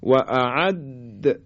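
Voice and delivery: و اعد slow, د held then bounced; qalqalah on doubled dal. Shown as a demonstration. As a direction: hold the د of اعد and release it with an echo. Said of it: "قلقله بدیم".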